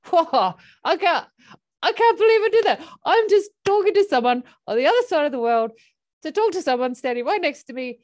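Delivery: high, loud, amazed gushing; elated horse. Retold or imitated. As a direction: squeal it out like a thrilled horse who can't believe it. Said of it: "I started feeling this giggling feeling inside me".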